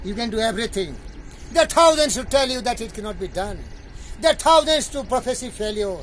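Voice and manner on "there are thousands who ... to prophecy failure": declaiming. Acted. Reciting a poem of warnings to his guest, mounting loud declamation. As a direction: build each warning, stretching the final words high.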